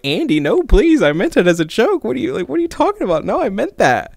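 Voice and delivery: high, pleading retold panic; acted nervous backpedalling; submissive and scared. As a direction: plead your way out, pitch jumping up. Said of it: "so submissive and scared".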